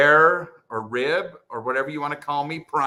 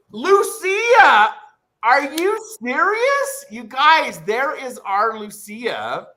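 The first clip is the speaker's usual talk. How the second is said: high, loud, drawn-out amazed cries; thrilled disbelief; 10 semitones higher, 7 dB louder, slower than usual. Real, not acted. Streamer finding an old friend in chat, thrilled shouting. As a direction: shout it in delighted shock, easing slightly.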